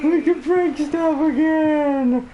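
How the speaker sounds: giddy laugh. Real, not acted. Giddy joy continues.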